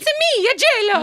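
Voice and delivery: very high loud Italian-American voice; hammy Italian accent. Retold or imitated. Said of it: "I go to Italian".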